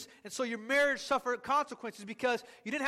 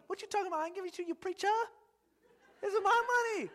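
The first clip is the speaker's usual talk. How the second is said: much higher, mock indignant; mock outraged squeal; 7 semitones higher, much faster than usual. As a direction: squeal it high with a laugh. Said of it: "there was a time where I felt like".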